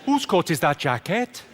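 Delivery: slow, puzzled Welsh lilt; confused Welsh accent. Shown as a demonstration. Comic demonstrates the secret of a Welsh accent, confused Welsh voice. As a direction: put on a baffled Welsh accent. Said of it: "To do a good Welsh accent, you've just got to sound confused".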